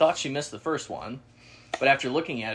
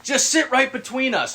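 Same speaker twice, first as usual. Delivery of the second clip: higher, louder cheerful offer; loud hunter guy; 12 semitones higher, 7 dB louder than usual. Retold or imitated. Stranger inviting them between guns, higher and loud. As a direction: offer it like it's no problem. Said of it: "He's like".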